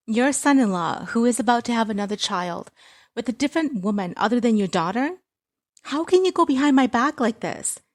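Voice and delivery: high, loud angry outburst; angry daughter. Acted. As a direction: tear into your mother, loud and high.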